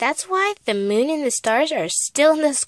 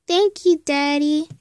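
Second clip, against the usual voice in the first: put-on small child; 3 semitones higher, 4 dB louder, slower than usual. Child thanks father, small child's voice. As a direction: say it like a grateful child.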